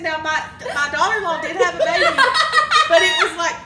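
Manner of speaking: laughing at the memory. Real, not acted. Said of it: "she said".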